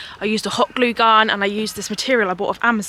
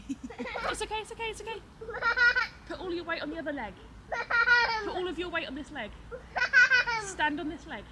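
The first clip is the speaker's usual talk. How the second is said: much higher, soft and far from the mic, praising; 8 semitones higher, 16 dB quieter than usual. Real, not acted. Mum coaching toddler in play.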